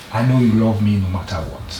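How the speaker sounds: ordinary delivery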